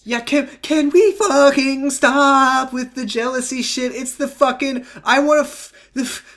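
high-pitched, drawn-out exasperation at a tired trope; fed-up high-pitched outburst; exasperated rant. Real, not acted. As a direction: vent your real frustration, voice shooting up high. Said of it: "I'm fucking sick of this dumbass trope".

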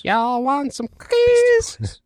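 high, slow, drawn-out nasal-free cartoon voice. Friends trading movie lines, high slow put-on voice. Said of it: "Beastie Boys".

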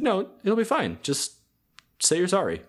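high-pitched, light coaxing voice; put-on high voice, brushing the worry away; acted reassurance. Voices friends reassuring her.

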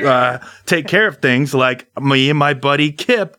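high, folksy rabble-rousing address; rousing crowd speech. Acted. Trevor on the statue rallies the crowd, high rousing speech. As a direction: win over the crowd, grand and chummy.

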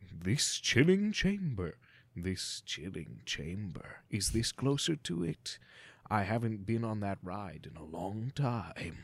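slow, hushed, spooky narration; scary ghost host voice. Acted. Trying a ghost host voice for the podcast intro, slow and hushed. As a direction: creep them out like a haunted ride host. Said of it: "I'm supposed to intro us in a scary voice".

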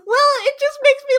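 ordinary delivery

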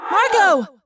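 high, loud cry of a friend's name; alarmed shout. Acted. Calling to a friend under attack, alarmed shout. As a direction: call out in alarm.

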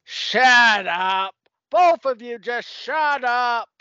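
loud gruff shut-up, a comic tough guy; mock angry shout. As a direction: bark it like a fed-up gang boss.

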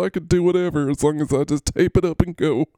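high, giggly player voice; T Hill. Acted. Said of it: "Why are you laughing so much, T Hill?".

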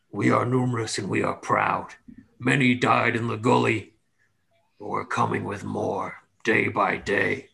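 lightly voiced orc boast; proud orcs. Orcs boast to the newcomer, lightly voiced and proud. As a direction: declare it as a proud orc, measured. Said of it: "they look back at you and they say".

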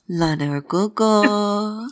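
slow, drawn-out, low "Lan Urgogo"; whispering a pet name to tease him; low teasing call. Acted. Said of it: "called in a low voice".

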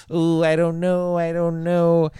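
high-pitched, dithering mock worry; mock hesitant. Imitating the reluctant killer before his bloodlust comes out, hesitant. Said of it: "his bloodlust does not come out immediately".

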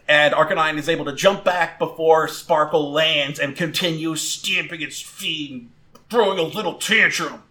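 ordinary talk

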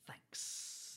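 hissed, drawn-out snake impression; slow sibilant snake voice; snake's thanks. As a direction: imitate a snake saying it with a long hiss. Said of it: "gives you the nice".

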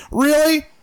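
sarcastic disbelief, voice jumping high; incredulous. Real, not acted. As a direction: scoff at it in disbelief. Scoffing at the quoted complaint.

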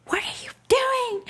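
high, loud retold scolding; angry outburst. Dad reenacting snapping at his child, high angry outburst. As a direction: shriek it in outraged disbelief.

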